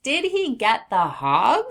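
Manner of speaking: excited rising question; question rise. Shown as a demonstration. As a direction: lift the voice up on hog?. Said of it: "our voice will go up at the end".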